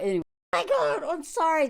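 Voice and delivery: ordinary delivery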